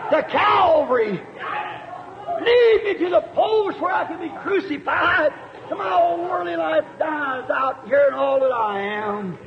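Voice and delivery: very high, loud shouted prayer; desperate shout. Acted. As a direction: cry out with everything you have. Preacher voicing Samson's prayer.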